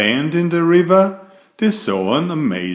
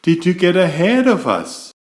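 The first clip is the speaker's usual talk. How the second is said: astonished; 7 semitones higher, 3 dB louder than usual. A narrator's reading. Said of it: "they gasped".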